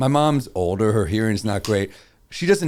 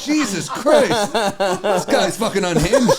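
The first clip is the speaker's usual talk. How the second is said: laughing disbelief; 5 semitones higher, slower than usual. Retold or imitated. Own reaction retold.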